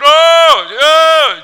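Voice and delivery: put-on very high, loud wailing child; mock tantrum wail. Kid throwing a fit at bedtime, loud high wail.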